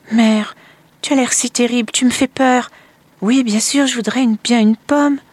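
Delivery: put-on high, loud child's voice, scared; a little boy daunted by his mother; boy pleading timidly with his stepmother, high and loud; frightened little boy. A narrator's reading.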